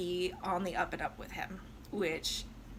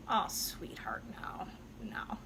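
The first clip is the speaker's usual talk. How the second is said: softer, whispered let-down; whispered rejection; 3 semitones lower, 7 dB quieter, slower than usual. Retold or imitated. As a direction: breathe it gently like turning a child away. Violetta gently rejects Alfredo.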